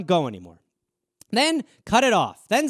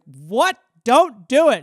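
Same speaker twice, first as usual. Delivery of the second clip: louder, higher startled objection; mock alarmed protest; 3 semitones higher, 8 dB louder, slower than usual. Arguing with own brain while driving, mock-alarmed. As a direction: jump in to stop a bad idea. Reacting to the intrusive thought.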